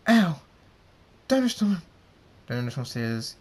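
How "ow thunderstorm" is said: high, loud yelp then scolding cry; startled yelp. Acted. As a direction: yelp in shock, then call him out loud. Rainbow Dash yelps, scolding him.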